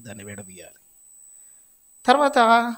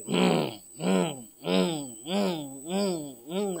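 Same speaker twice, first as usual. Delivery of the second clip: dove cooing; much slower than usual. Retold or imitated. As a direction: hum it like a moaning pigeon. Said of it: "అంటానే ఉంటుంది". (పావురం మూలుగులా మెల్లగా ఉమ్ ఉమ్)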